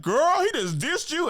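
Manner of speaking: high-pitched homegirl impression; shocked homegirl. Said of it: "she was with a homegirl".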